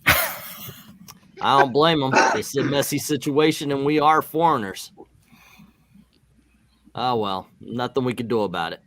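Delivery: normal talking voice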